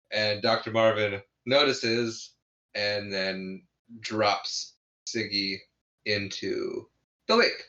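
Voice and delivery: normal talking voice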